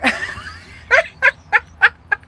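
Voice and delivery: long, very high and loud cackle; high cackling laugh. Real, not acted. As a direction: burst into a shrieking cackle. Continued laughter.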